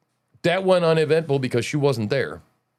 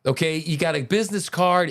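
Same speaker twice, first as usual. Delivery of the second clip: ordinary talk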